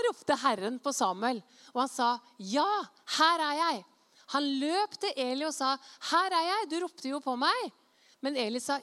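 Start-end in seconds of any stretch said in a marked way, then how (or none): 2.4-3.8 eager answering call. Acted. Boy answers a call in reading. 6.1-7.7 put-on high, loud young servant reporting in; acted eager reply; eager answering call. Samuel answers Eli eagerly.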